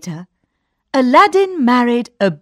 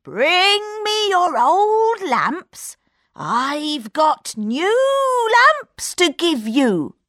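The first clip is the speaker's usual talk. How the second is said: put-on high, slow old man; coaxing old trader; old peddler voice; 9 semitones higher, slower than usual. A narrator's reading.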